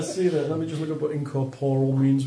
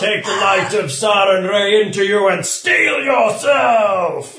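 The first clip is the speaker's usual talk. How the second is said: loud, slow, high exhortation; rally her with holy fervor; heroic rallying cry; 7 semitones higher, 10 dB louder, slower than usual. Acted.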